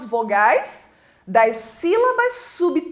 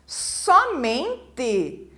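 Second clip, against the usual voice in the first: "Somente" slowly split into So-men-te; syllable by syllable; 3 dB quieter than usual. Shown as a demonstration. Teacher showing pupils where the stress moves, "Somente" syllable by syllable. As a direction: break "Somente" into separate, measured syllables. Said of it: "Agora eu tenho outra sílaba tônica aqui".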